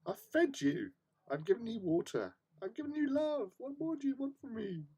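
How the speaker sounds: high, pleading exasperation; mock exasperated owner. Owner mock-pleads with the demanding cat, high. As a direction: whine at the cat like a put-upon parent.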